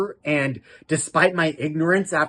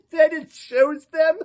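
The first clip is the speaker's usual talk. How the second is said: laughing; 12 semitones higher than usual. Real, not acted. Giggling through the recap.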